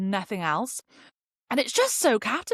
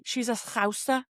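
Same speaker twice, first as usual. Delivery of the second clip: light put-on Scouse twang; a hint of mock Scouse; Scouse accent; 3 semitones higher than usual. Shown as a demonstration. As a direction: say Scouser with a touch of Liverpool accent.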